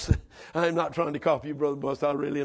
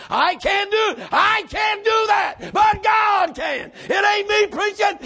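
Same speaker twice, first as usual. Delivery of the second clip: fiery shouted preaching; 11 semitones higher, 5 dB louder, slower than usual. Acted. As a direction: preach it with full force, slow and loud. Preaching at full force.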